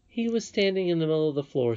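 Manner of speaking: plain reading voice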